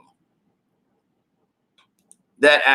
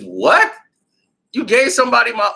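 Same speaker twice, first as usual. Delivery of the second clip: outraged disbelief; 6 semitones higher, 4 dB louder than usual. Retold or imitated. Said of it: "And he's".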